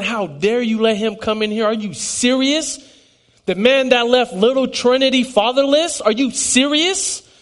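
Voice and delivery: mock outrage at grandma, not shouted; retold angry outburst. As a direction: replay the furious question, pitch climbing. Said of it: "I was very mad".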